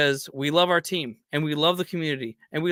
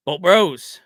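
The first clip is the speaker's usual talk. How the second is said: rallying sign-off; 3 semitones lower, much slower than usual. Real, not acted. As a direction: call the crew together, hyped. Sign-off rallying call to fans.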